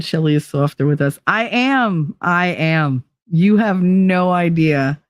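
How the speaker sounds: normal talking voice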